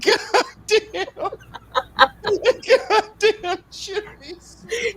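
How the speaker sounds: high, wheezing laughter through the words; laughing hard. Real, not acted. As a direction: barely get it out for laughing. Helpless laughter at the joke.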